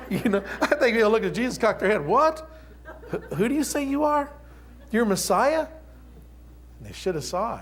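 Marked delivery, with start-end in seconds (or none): 2.0-5.7 high, bemused questioning voice; puzzled onlooker. Retold or imitated. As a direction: play a baffled villager squinting at him. People puzzled at Jesus, acted.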